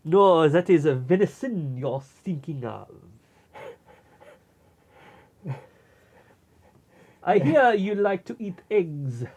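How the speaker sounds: put-on a pompous Belgian sleuth accent; Belgian detective voice. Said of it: "We were just doing a bit".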